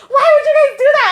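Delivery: high, loud wounded wail; retold tearful outburst. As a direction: cry out in hurt, acting it up. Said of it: "my eyes teared up".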